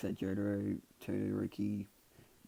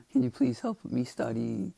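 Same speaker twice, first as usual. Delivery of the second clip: slow, louder mock begging; pleading; 4 semitones higher, 7 dB louder than usual. Student begging Momo for study help, slow pleading. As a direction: draw out the plea, pitch lifted. Acted plea to a classmate.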